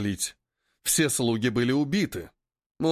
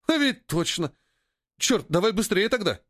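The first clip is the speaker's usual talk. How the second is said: higher, mildly urgent exclamation; raised voice with a touch of alarm; sudden realization; 6 semitones higher than usual. A narrator's reading. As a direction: catch yourself, then hurry the other along. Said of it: "спохватился Поганка".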